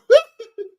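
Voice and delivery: sudden high cackle; real high laugh; high burst of laughter. Real, not acted. Reacting to chat.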